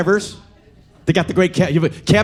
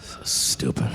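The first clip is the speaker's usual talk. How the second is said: whispered aside; 11 dB quieter, slower than usual. Real, not acted. Muttered aside.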